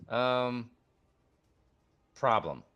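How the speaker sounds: lightly voiced, sheepish; gnome pilot voice. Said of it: "He goes".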